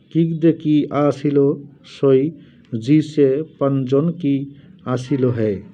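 slow, careful reading of "কিক দে কি আসিল সই", syllable by syllable; reading the pronunciation. Shown as a demonstration. Showing viewers how the Urdu mantra is pronounced, slow and careful. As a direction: sound out "জি সে পঞ্জন কি আসিল হে" slowly and deliberately. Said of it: "এইভাবে মন্ত্রগুলো উচ্চারণ করে দেওয়া আছে".

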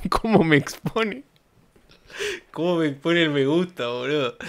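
high, incredulous mocking voice; mock outrage. Streamer mocking a tweet's complaint, in mock indignation. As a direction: parody the poster's indignation.

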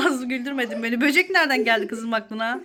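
normal talking voice

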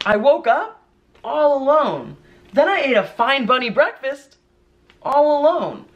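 high-pitched bunny telling its story; rabbit storybook voice. Acted. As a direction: read as a little bunny to a child. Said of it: "What kind of voice do rabbits have".

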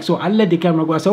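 ordinary delivery

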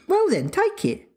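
high squeak breaking into laughter; laughing. A narrator's reading. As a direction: giggle through the dare. Father and son bickering.

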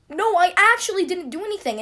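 high, loud tearful insistence; imitated sincere cry. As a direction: plead innocence as if truly crying. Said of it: "My cry was like".

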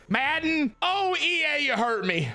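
high, wailing call to EA; mock anguish. Real, not acted. Gamer wailing at EA over no upgrade, high. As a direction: cry out to EA in mock pain. Lament to the game maker.

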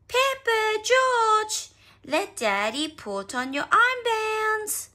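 put-on high, loud mum calling her children; Mummy Pig calling. A narrator's reading. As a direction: call out slowly and loudly to the kids. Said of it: "snort Mummy Pig".

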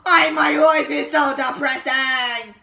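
slow loud fake wailing; mock wailing. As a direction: wail with hammed-up despair. Mock despair.

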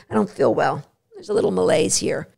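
soft, fading to a whisper; a weak, poorly dog voice; feeling unwell dog. Pretend.

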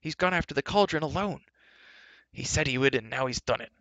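alarmed. A narrator's reading. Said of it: "Terran cried".

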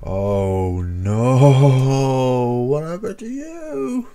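horrified at the swollen friend; shocked outcry. Real, not acted. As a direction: recoil at what you see. Finds friend swollen and hurt.

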